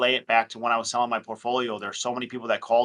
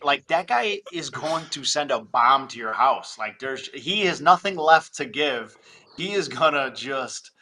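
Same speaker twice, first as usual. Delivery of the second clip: ordinary talk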